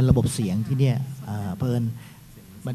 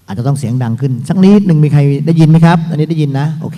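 louder so the room can hear; speaking up; 2 semitones higher, 12 dB louder than usual. Real, not acted. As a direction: project it for the hard of hearing.

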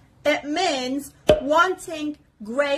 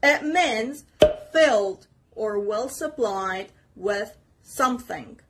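ordinary talk

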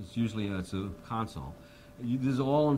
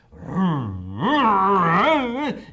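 motorcycle rev noise; 10 semitones higher, 12 dB louder than usual. Retold or imitated. Imitating the guitar motorcycle sound.

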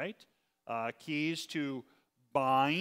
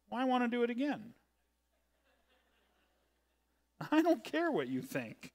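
fast, higher, petulant teasing; mock stubborn; 7 semitones higher than usual. Pastor teasing class about redoing a lesson, mock stubborn. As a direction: play the stubborn pastor, then drop it. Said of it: "I'm joking".

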